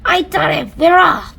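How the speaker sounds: very loud triumphant yell; shouted. Acted. Centipede shouting through the ceiling hole, loud triumphant yell. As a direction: crow it in triumph. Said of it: "and shouted".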